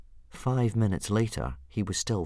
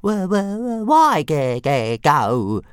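high, stuttering, frightened dragon squeak; a tiny terrified dragon clinging on; small dragon too scared to let go; shuddering little dragon; 9 semitones higher, 10 dB louder, much slower than usual. Acted. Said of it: "shuddered Toothless".

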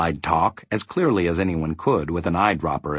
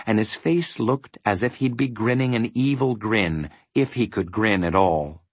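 ordinary delivery, nothing marked

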